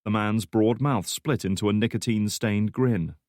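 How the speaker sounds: ordinary delivery, nothing marked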